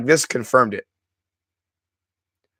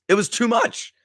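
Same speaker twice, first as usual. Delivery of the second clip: overwhelmed; 7 semitones higher, 3 dB quieter than usual. Real, not acted. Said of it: "couldn't contain myself".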